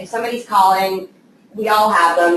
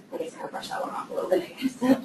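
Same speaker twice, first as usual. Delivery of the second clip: sheepish whisper; 6 semitones higher, 11 dB quieter than usual. Real, not acted. Joking admission, laughs.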